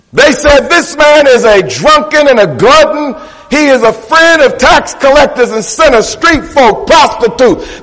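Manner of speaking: high, driving sermon delivery; full-force preaching. Acted. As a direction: preach it hard, punching each repeated phrase.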